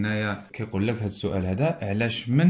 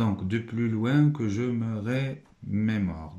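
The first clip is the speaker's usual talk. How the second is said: slow reading; much slower than usual. Shown as a demonstration. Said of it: "تيكست".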